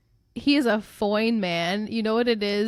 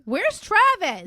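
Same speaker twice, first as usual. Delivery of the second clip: high, drawn-out call; theatrical plaintive demand; mock calling out; 7 semitones higher, slower than usual. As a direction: call for the missing boy, stretched out. Calling out for absent boys.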